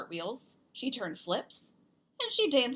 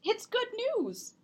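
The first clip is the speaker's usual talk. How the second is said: much higher, warmly upbeat; mom's bright voice; 13 semitones higher than usual. Acted. Mom reassures Zoe after her fall, much higher. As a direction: lift good news up high. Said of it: "said Zoe's mom".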